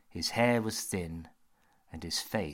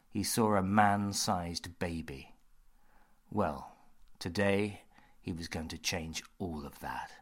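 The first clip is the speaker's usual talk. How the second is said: ordinary delivery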